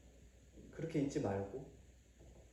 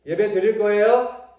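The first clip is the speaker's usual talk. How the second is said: loud demand; 6 semitones higher, 14 dB louder than usual. Real, not acted. Parent demanding a loud answer.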